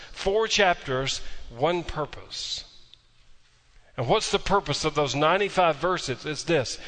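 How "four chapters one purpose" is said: slow deliberate emphasis. Real, not acted.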